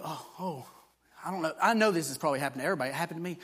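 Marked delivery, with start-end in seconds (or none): 0.0-1.5 doubting believer. Retold or imitated. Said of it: "And then you".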